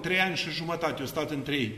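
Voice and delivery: ordinary talk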